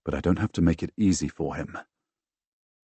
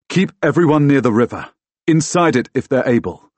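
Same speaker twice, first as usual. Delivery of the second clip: raised, higher commanding voice; urgent orders; 8 semitones higher, 7 dB louder than usual. A narrator's reading. Fighter tells friend how to protect people, urgent orders. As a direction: give orders loudly and urgently.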